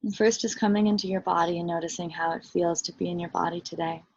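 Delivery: soft, unhurried meditation voice with long pauses; guided meditation. Real, not acted. Teacher leading listeners into body and breath, guided meditation. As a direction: guide them gently inward, softly and evenly.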